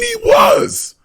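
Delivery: high, loud insistence; shouted insistence in a sports debate; shouting. Real, not acted. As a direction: slam the point home. Insisting loudly in argument.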